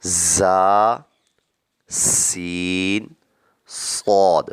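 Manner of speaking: the letters زا، سین، صاد with a sharp whistling hiss; sibilant letters. Shown as a demonstration. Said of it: "وہ تیز آواز سے پڑھے جائیں گے".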